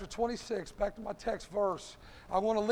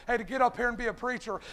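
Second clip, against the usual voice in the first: ordinary talk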